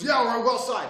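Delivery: loud, high shout; aggressive agreement. Retold or imitated. Attacker agreeing to go outside, shouting. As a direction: imitate a riled-up man accepting a fight.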